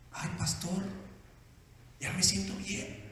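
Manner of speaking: soft, low, spent voice; drained relief. Acted. Husband telling pastor he feels well after crying, relieved. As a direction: let it out quietly, worn out.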